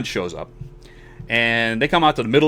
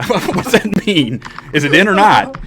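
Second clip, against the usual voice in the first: higher, louder mock demand; retold exasperation; 8 semitones higher, 5 dB louder, slower than usual. Patient challenges doctor after x-rays, retold exasperation. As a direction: act out demanding a straight answer.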